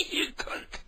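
breathy whisper, mocking the caller; whispered mock echo. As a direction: whisper the insult back.

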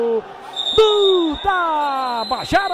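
long drawn-out goal scream; explosive goal commentary. Real, not acted. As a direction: hold the goal call loud and long. Narrator calls the goal. (grito de gol explosivo)

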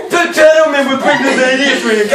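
high, put-on character voice; hammed-up recital. Exaggerated repeat of the line.

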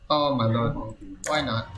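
usual speaking voice

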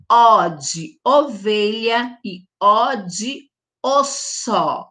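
slow "O de ovelha e O de osso", every "O" spelt out clearly; each O pronounced. Shown as a demonstration. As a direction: lay out each O slowly. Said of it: "eu digo O de ovo".

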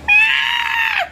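high-pitched scream of fright; long, piercing cry of fear; frightened scream. Acted. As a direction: scream in acted terror. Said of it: "I'm so scared".

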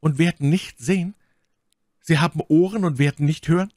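high, emphatic reproachful cry; anklagender Ausruf. A narrator's reading. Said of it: "rief My Lady". (vorwurfsvoll gerufen, hoch)

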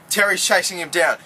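ordinary talk

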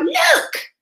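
a high excited cry; cries out. A narrator's reading. Matt spotting the lost bear in the library, crying out high. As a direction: call it out as you spot him. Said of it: "then Matt cries out".

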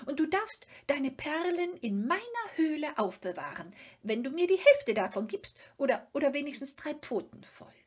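high, fast, loud creature voice; bargaining Sniff. Acted. Sniff bargains with Moomin over the pearls, high and fast. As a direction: haggle eagerly, tumbling over the words.

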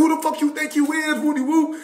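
high mock-sergeant challenge; half-volume retold yell; yelling drill sergeant. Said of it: "little dude that was yelling".

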